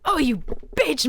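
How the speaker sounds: high pained angry cry; screamed. A narrator's reading. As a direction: yell through the pain. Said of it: "Mike screamed".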